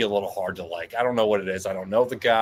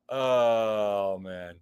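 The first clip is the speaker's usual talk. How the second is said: ordinary talk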